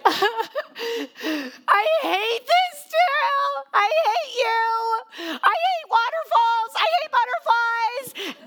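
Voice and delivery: put-on slow, very high wailing child; whiny child meltdown. Exhausted daughter refusing to walk, very high slow whiny wail. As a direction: wail it like a worn-out eight-year-old.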